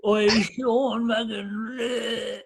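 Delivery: high, hammed-up drunk calling out; drunk Jerry. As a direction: imitate a wasted mate hailing someone. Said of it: "he was munted".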